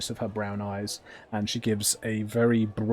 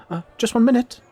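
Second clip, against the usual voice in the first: high, raised timid call; somewhat timid; 13 semitones higher, 10 dB louder, faster than usual. Acted. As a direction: call through the door, a little timidly. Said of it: "a somewhat timid voice from behind the door".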